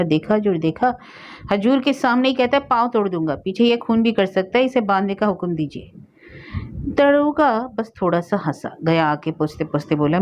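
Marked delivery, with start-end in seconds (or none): none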